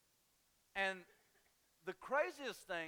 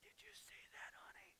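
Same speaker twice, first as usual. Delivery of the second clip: hushed whisper to a spouse; whispered gossip; 16 dB quieter than usual. Pretend. Churchgoer whispers to spouse about the zombie baby, hushed. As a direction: lean over and whisper in shock.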